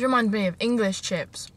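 eating between words; talking while chewing. Real, not acted. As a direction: mouth partly full. Seen 2: Cheeks and lips move like chewing early on; later she just holds food.

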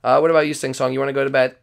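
ordinary talk

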